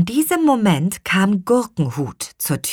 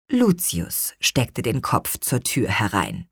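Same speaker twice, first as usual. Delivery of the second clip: ordinary delivery, nothing marked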